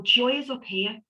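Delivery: ordinary talk, nothing marked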